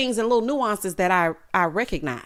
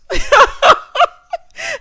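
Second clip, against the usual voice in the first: high, loud burst of laughter; real high-pitched cackle; 11 dB louder than usual. Real, not acted. Laughs at what she said.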